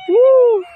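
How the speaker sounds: loud, high "woo"; ringing cheer; excited whoop. Real, not acted. Cheering during the ride.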